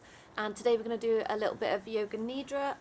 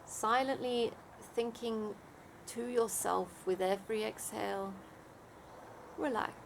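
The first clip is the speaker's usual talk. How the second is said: guided meditation; 4 dB quieter than usual. Acted. Leads a Yoga Nidra meditation.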